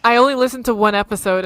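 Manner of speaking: usual speaking voice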